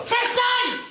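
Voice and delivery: a high, loud, furious scream; wife screaming at her husband to stop, full fury; furious shout. Acted. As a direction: explode at him to stop.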